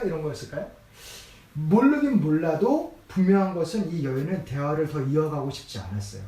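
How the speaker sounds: ordinary talk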